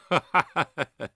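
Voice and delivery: delighted acted "ha-ha-ha"; big amused belly laugh; hearty laugh. A narrator's reading. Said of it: "他看得大乐， 笑着道".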